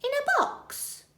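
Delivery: very high incredulous echo; startled Betty. Acted. Betty hears about the box, incredulous. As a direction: catch on suddenly. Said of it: "says Burglar Betty".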